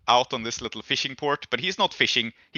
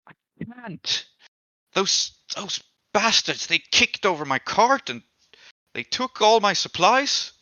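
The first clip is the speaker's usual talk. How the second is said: upset complaint; 9 semitones higher than usual. Acted. Bruised drunk tells of attack.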